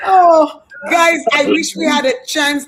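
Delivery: loud, high exclamation; excited outburst. Real, not acted. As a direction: burst out to the audience.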